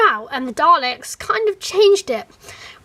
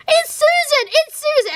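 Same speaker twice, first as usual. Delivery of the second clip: much higher, louder fangirl squeal; excited fan squeal; 12 semitones higher, 4 dB louder than usual. Retold or imitated. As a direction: squeal it like you just spotted her. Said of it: "I'm just like".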